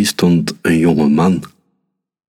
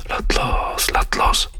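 whispered thought; 6 dB quieter, slower than usual. Acted. Rosie's anxious inner thought.

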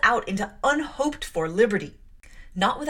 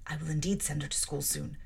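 low, softer sotto voce aside; murmured aside; 4 semitones lower, 7 dB quieter than usual. Acted. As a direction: mutter it under your breath. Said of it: "murmured Missus Reed sotto voce".

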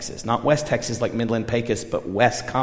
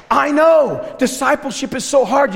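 much higher and louder, bursting with enthusiasm; retold eager pep talk; 13 semitones higher, 7 dB louder than usual. Urging pastor Trini on, an eager pep talk. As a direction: fire him up with a big rush of sympathy. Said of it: "And I said".

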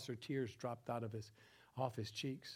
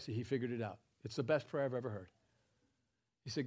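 normal talking voice

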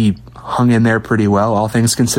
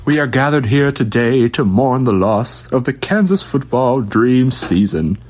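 mock eulogy; 2 semitones higher than usual.

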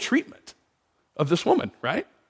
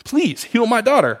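higher, lightly voiced pleading mother; 7 semitones higher, slower than usual. Mother begging Jesus to heal her daughter, lightly pleading. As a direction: beg for the daughter, a touch lifted.

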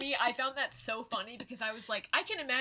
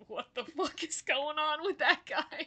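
laughing; 7 semitones higher than usual. Retold or imitated. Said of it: "and just being like".

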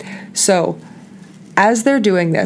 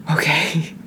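whispering to calm down; breathy self-soothing whisper; whispered calming breath; 7 dB quieter than usual. Retold or imitated. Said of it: "going through some breath work".

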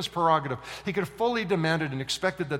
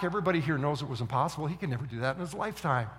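ordinary delivery

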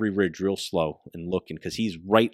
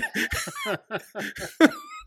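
big laugh. Real, not acted. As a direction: laugh out hard. Laughing at the memory.

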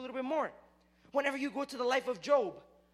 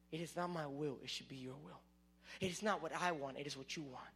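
soft surrender; 7 semitones lower, 7 dB quieter than usual. Retold or imitated. Jesus surrendering to God's will.

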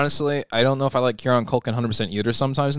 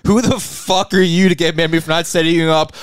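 raised, indignant comeback; indignant rant; 4 semitones higher, 4 dB louder than usual. Real, not acted. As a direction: turn the complaint back on your co-host. Bickering back at co-host.

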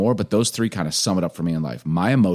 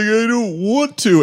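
put-on crying kid; 6 dB louder, slower than usual. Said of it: "he started crying".

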